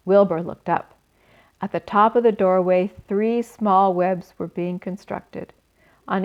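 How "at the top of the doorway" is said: ordinary delivery, nothing marked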